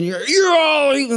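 cut-short mock tirade; mock fist-pounding rant. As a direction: play at a pulpit-pounding rebuke. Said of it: "I'm not a pound the fist".

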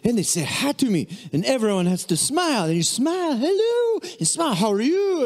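high, hammed-up mock cheerfulness with big smiles; over-exaggerating Frenchman. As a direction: overplay the bewildered French visitor. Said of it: "he was kinda over-exaggerating".

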